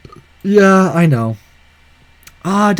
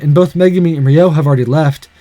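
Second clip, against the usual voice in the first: normal talking voice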